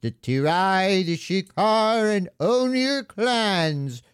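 high, slow squeaky centaur voice; mock the centaur like a chipmunk. Mocking the centaur voice line, high chipmunk squeak. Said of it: "made her sound like she's from Alvin and the Chipmunks".

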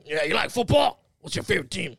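loud, raspy New York salesman imitation. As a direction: pitch it in a put-on smoker's rasp. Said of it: "super raspy".

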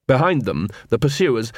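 plain reading voice

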